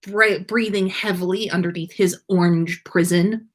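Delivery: ordinary delivery, nothing marked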